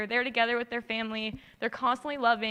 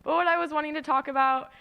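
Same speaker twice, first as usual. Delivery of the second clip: usual speaking voice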